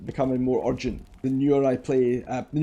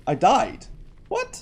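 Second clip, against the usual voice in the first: surprised; 10 semitones higher, much slower than usual. Real, not acted. Sudden death in game.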